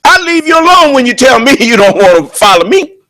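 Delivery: ordinary delivery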